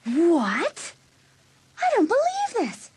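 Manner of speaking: high, stunned disbelief; jealous girl seeing her boyfriend with another; shocked disbelief. Acted. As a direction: blurt it out in outraged shock. Brenda sees Hallie with Ted.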